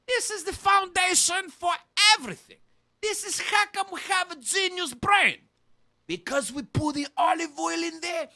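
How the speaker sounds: loud, high proud bellowing; boastful rant. Acted. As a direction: brag at full volume. Bragging Greek character, ranting.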